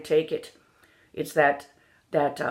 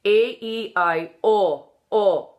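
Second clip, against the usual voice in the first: Northern Irish vowels; 2 semitones higher, 4 dB louder, slower than usual. Shown as a demonstration. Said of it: "That's why we're going".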